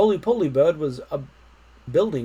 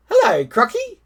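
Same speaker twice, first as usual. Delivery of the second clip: cheeky monkey; 9 semitones higher, 7 dB louder than usual. Acted. Monkey voice in story.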